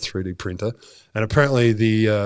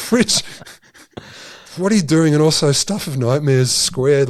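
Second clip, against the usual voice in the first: incredulous; 4 semitones higher than usual. Real, not acted. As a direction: ask it in stunned disbelief. Disbelief at spider robot.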